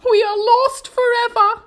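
high, loud ladybird lament; despairing shout. A narrator's reading. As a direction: cry out in hopeless alarm. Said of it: "shouted the ladybird".

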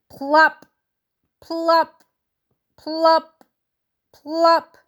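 popped-out plops; plop sound effect. A narrator's reading. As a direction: pop each plop like a drop. Sound of hailstones.

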